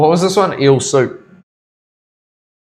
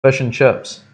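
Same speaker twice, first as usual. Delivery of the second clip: Kiwi accent; slower than usual. Shown as a demonstration. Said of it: "What do they say?".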